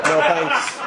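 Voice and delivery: loud laughing refusal; firm no. Acted. Rider turning down the passenger's cocaine, loud laughing no.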